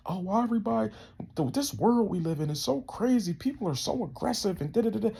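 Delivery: high-pitched mimicry of complainers; mock complaining. Imitating people who bitch about this crazy world, mock complaining.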